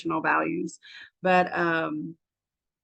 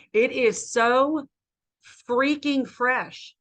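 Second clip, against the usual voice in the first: slow, high and savoring; higher pitch, slow, delighted; drawn-out rave; 5 semitones higher, much slower than usual. Real, not acted. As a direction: stretch it out, marveling at the fish. Raves about fresh fish.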